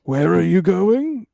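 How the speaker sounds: put-on high, loud old man's croak after a cough; cough, then call out slowly as a confused elder. Ancient villager asks departing people where they're going, high and loud. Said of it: "is so old. He's so old".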